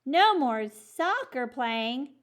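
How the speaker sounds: slow, loud, high dismayed cry; called out in dismay. A narrator's reading. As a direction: call it out, stunned by the rule. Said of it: "Julio called out".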